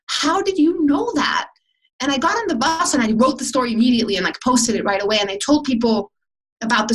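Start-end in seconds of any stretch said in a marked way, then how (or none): 0.1-1.5 higher, slow, awed question; the teller, stunned by the stranger's words, asking slowly. Retold or imitated. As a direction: ask it in disbelief.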